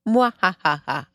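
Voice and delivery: theatrical evil vampire cackle; Dracula evil laugh. Shown as a demonstration. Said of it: "me trying to make a scary Dracula voice".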